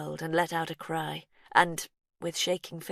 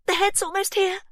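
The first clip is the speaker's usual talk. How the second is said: high, loud urgent call; excited cry; 8 dB louder than usual. A narrator's reading. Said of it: "Verity cried".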